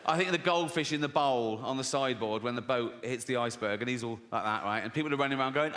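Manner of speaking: ordinary talk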